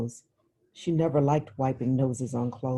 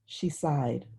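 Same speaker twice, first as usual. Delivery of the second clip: ordinary delivery, nothing marked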